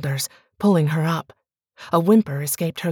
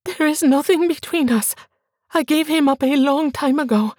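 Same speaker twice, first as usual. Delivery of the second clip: frightened pleading wife; 9 semitones higher than usual. Acted. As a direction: cry out mi amor in fear, stress on mi. Bianca denies fearfully.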